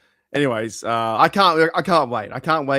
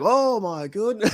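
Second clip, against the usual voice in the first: amazed; 7 semitones higher, slower than usual. Retold or imitated. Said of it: "'cause I was like".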